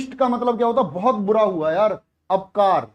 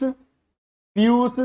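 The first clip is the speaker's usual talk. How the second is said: slow dictation; 2 semitones higher, much slower than usual. Shown as a demonstration. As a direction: stretch each syllable of Piyush out, very slow and clear. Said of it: "अमृत का पीयूष". (पीयूष को बहुत धीरे, खींचकर बोलना)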